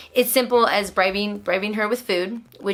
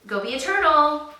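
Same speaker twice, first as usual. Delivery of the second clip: coaxing encouragement; 6 semitones higher, 4 dB louder than usual. Real, not acted. Coaxing child to act.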